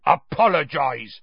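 snarled demand; angry, snarling order; Hagrid. A narrator's reading. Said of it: "Hagrid snarled".

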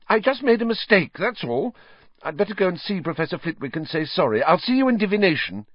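voiced high, rushed schoolgirl excuse; Hermione, brushing it off. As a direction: hurry off, insisting it's nothing.

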